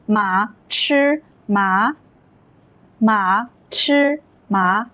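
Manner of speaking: very slow, each syllable held; deliberate teacher's model reading; tone demonstration. Shown as a demonstration. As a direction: stretch out each Mandarin tone. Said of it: "notice that the tones are changing".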